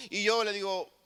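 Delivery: usual speaking voice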